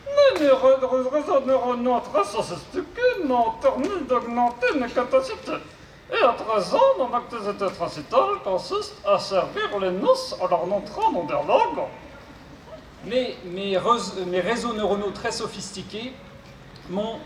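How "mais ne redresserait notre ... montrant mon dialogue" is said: high robot voice with garbled consonants. Acted. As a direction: mangle the consonants like a glitchy robot. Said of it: "souffre d'une pathologie vocale".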